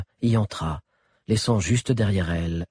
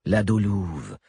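plain reading voice

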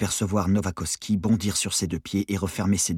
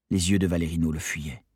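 ordinary delivery

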